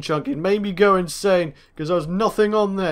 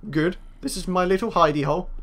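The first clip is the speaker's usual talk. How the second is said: ordinary talk, nothing marked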